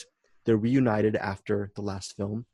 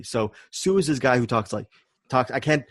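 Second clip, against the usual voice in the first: normal talking voice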